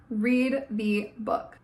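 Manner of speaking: slow, spaced-out command; slow insistence. Real, not acted. Vlogger urging viewers to read, slow. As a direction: drag out each word as a plea. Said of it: "again, read the book".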